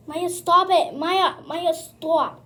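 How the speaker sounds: loud scolding shout; yelling at the dog. Real, not acted. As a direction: yell at the dog to stop. Scolding the dog during the bath.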